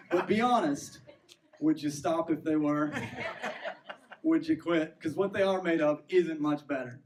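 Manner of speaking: preacher's loud challenge to the congregation; emphatic loud challenge. Real, not acted. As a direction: lean in and push the question hard. Preacher pressing the audience loudly.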